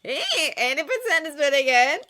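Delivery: high, loud, indignant dialogue voice; animated character protesting proudly; excited story character. A narrator's reading. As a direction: pitch it up and push it out boldly.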